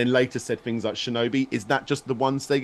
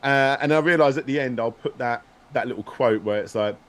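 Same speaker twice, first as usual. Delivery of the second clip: ordinary talk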